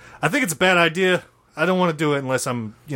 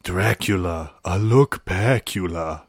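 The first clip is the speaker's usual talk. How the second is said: low slow show title; low announcer voice; 8 semitones lower, slower than usual. Acted. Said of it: "it's time for Dracula".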